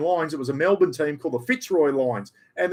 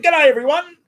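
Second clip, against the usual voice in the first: high, louder cheery dog voice; voicing the dog; 13 semitones higher, 8 dB louder than usual. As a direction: speak for the pet dog, lightly hammed. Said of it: "He said".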